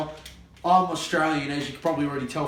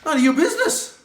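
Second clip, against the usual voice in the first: very high, loud shout; furious shout; 12 semitones higher, 8 dB louder than usual. Acted. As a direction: shout it down at him. Quarrel over eaten pizza.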